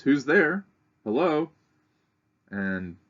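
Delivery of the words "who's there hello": calling out at the door. Retold or imitated. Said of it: "eventually somebody responds".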